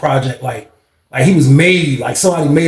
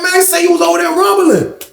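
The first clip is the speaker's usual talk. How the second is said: excited gossip; 11 semitones higher than usual. Retold or imitated. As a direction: blurt it out amazed. Friends asking about the fight.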